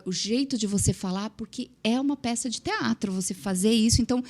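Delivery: ordinary delivery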